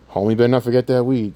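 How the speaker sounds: usual speaking voice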